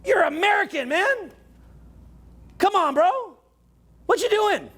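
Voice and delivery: high-pitched, bro-ish scolding; exasperated rant. Retold or imitated. Canadian nudging Americans to be American, high exasperated. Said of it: "and I'm like".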